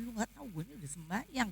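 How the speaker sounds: quick, soft aside to oneself; inner grumble. Retold or imitated. Wife silently answering her husband, quick hushed grumble. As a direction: rush it under the voice as a private retort. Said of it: "Batin saya".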